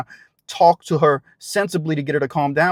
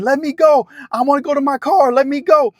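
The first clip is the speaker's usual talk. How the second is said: imitated struggling plea; 8 semitones higher than usual. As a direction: voice the woman fighting to get free. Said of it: "She's like".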